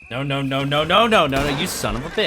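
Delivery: loud, rising string of protests; alarmed protest. Real, not acted. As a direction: shout in mounting frustration, peaking midway. Protesting as the game goes wrong.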